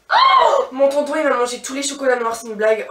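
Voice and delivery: loud mock-outraged cry, fast; outraged shout. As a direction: yell it in fake outrage about the chocolates. Indignation comique, cri fort. (cri d'indignation comique)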